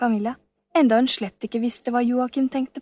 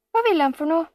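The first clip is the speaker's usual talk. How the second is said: higher, urgent question; girl asking about the man, worried and higher; worried child; 7 semitones higher, 3 dB louder, faster than usual. Acted. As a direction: ask anxiously. Anxious girl asking about villain.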